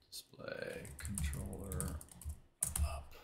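very soft, low muttering to self. Real, not acted. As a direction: mumble under the breath while searching. Muttering to self while searching.

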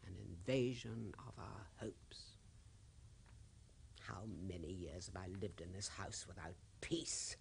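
soft whispered lament; mournful whisper. Acted. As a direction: let it sink to a whisper. Grieving widow's lament.

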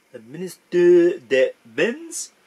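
slow, loud, hammed-up French accent; butchered French accent. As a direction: overdo the French accent, drawn out. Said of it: "I've just butchered that French accent completely".

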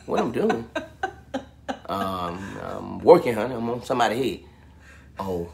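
low, hushed, hesitant phone murmur; mock bedroom talk. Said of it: "that bedroom talk stuff".

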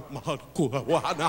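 lazy mock Hawaiian phrase with a laugh; mocking island locals, mock Hawaiian. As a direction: toss off a put-on island voice, chuckling. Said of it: "They just sit around going".

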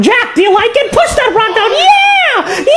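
high hyped-up shouting, building to a yell; rowdy cheering. Real, not acted. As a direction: egg him on, rising to a screamed cheer. Cheering on rock pushing.

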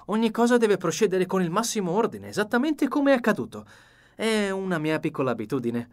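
the meticulous detective demanding order; Poirot, insistent. A narrator's reading.

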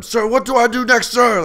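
very high, loud movie-recruit yell; imitated screaming. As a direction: scream the question like an over-eager trainee. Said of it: "he's, like, screaming at him".

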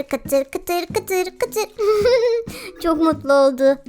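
ordinary talk, nothing marked

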